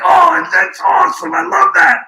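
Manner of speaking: high, loud, slow overjoyed outburst; hammed-up whoop of delight, far above his own voice; imitating excited noises. Imitating Bobby listening to the treatment on the phone, in a high, loud mock whoop. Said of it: "making noises in the background".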